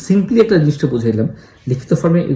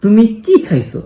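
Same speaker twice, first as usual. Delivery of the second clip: higher কি, slow, asking what was eaten; stress on কি; 7 semitones higher, 3 dB louder than usual. Shown as a demonstration. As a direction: pitch up on কি and ask slowly. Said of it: "কিতে জোর দিই".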